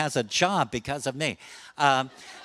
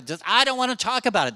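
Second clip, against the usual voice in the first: high, loud, fast and irritated; angry refusal; 9 semitones higher, 4 dB louder, faster than usual. Retold or imitated. Older man refusing to discuss his health with the therapist, angry. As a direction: snap back to shut the talk down. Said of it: "Then they get mad".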